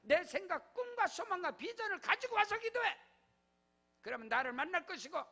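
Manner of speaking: loud, high pulpit proclamation; full-force preaching. Acted. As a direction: decree it over the congregation. Preaching blessing and decree. (강단에서 외치는 설교)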